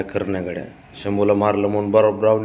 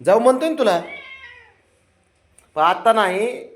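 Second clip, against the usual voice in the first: coaxing pet talk; 11 semitones higher, 4 dB louder than usual. Real, not acted. Owner coaxes his sulking dog not to sulk, high sing-song baby talk. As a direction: coax a sulking pet to cheer up.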